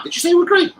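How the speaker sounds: high delighted surprise; excited disbelief. Real, not acted. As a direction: jump on the good news. Excited by a winning bet.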